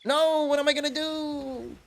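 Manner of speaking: high, panicky inner wail; mock panic. Alone at the cinema with no English film, mock panic. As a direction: act out mild dread of the Thai-only movie. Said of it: "I was like".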